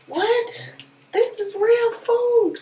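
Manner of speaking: can't believe what's in the box; amazed disbelief. Real, not acted. Unboxing, shocked at real food.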